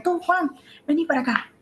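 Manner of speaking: mamá que llama con rabia, aguda; mamá enojada. Retold or imitated. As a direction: imita a una madre enfadada llamando al hijo. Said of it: "puede ser su mamá que le está diciendo". (mamá llamando con rabia)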